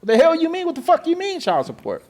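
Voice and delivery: indignant cursing disbelief; outraged disbelief. Retold or imitated. Telling HR about the child support, outraged.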